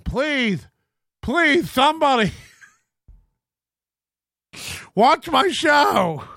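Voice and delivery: high, building, hammed-up begging; mock desperate plea. Imitating Corey begging somebody to watch, mock desperate. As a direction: plead loudly and pathetically, stretched out.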